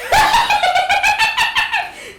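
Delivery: high burst of helpless laughter; loud high cackle. Real, not acted. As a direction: break up laughing. Laughing at drunk karaoke story.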